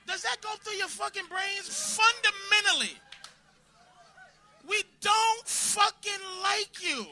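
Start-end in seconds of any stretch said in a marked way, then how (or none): 2.0-7.1 slow shout. Acted. Comic ranting at women in audience.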